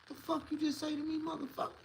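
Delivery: high, strained mock threat; hammed-up angry grown man squeezing his voice up high; menacing challenge. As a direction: loom over him and demand an answer. Confronting the kid.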